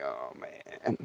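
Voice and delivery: quiet, sinking dread; barely said, fearing the worst; hushed dread. Retold or imitated. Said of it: "And I'm like".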